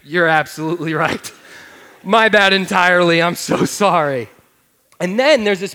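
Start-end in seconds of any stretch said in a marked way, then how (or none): 0.0-4.3 mock sheepish apology. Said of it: "and I say".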